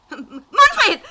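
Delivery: loud stammered scream of his name; terrified cry. Acted. Wife screams husband's name as intruder enters, terrified. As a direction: cry out for help.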